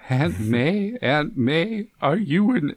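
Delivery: halting, rising impression of a wondering nephew; quoting Peter. Peter asking Aunt May about angels, hammed-up wonder. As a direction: stumble upward into an awed question.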